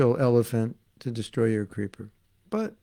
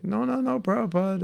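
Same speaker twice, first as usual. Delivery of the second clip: high, eager protest; voicing the disciples' quick denial, pitched up; mock pleading disciples; 9 semitones higher than usual. Disciples protesting to their apologizing guru, high and eager.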